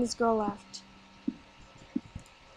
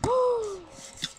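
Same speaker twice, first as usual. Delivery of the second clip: sharp gasp; 10 semitones higher than usual. Real, not acted. Sudden danger in the game.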